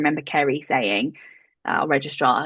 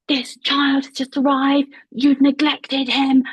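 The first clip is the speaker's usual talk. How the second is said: higher, louder mock yell down the phone; retold furious phone rant; 8 semitones higher, 4 dB louder, slower than usual. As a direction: replay the registrar tearing into the hospital. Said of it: "screaming down the phone at Brighton".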